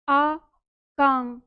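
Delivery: आकांग held very long on the nasal ं; teacher isolating the nasal sound; drawn-out syllable. Shown as a demonstration. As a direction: stretch आकांग to show the anusvara. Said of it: "अनुस्वार है यहाँ पर".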